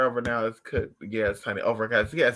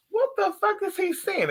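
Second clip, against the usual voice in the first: much higher, startled cry of disbelief; shocked disbelief. Retold or imitated. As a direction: squeal it like you can't believe your eyes. Retold bafflement at dog.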